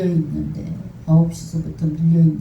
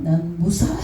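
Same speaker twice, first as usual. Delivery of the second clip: ordinary delivery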